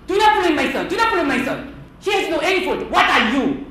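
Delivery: loud, high defensive scolding; acted outburst of a protective mother; indignant Filipino mother. Filipino mother defending her son from scolding, indignant. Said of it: "Filipino mother".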